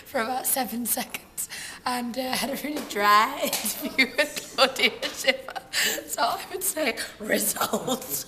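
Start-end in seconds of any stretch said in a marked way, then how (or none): 2.3-8.2 laughing. Real, not acted. Laughing through interview story.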